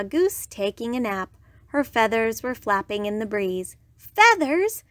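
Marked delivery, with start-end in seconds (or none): 4.0-4.8 high, loud delighted exclamation; excited cry. A narrator's reading. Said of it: "cried Iguana".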